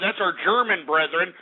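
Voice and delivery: normal talking voice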